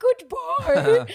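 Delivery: high, loud singsong goodbye; flight attendant farewell. Retold or imitated. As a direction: send passengers off in a cabin-crew lilt. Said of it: "flight attendants who are just like".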